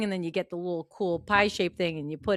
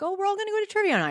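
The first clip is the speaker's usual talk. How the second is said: high, fast eager invite; mock eager friends; 13 semitones higher, much faster than usual. Friends inviting her to trivia night, high eager. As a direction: gush about trivia night. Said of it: "I've had people be like".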